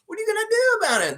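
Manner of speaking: high, fast whiny question; helpless whine. Retold or imitated. The superfan asking what he can do, fast and whiny. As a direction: whine it like there's nothing to be done.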